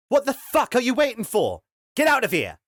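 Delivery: high, loud furious yell; angry shout. Acted. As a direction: scream at them to get out. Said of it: "Henry shouted at the slaves".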